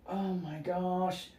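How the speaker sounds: slow, soft, breathless oh my gosh; a hushed mock swoon; awed gasp. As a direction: let it melt in awe. She tastes the cream.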